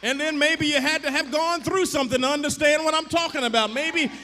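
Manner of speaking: building from lively preaching to a loud, high, shouting sermon; preaching at full force. Real, not acted. Preacher shouting at the congregation about God's grace, full-force preaching. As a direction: whoop it up, pushing every line to the congregation.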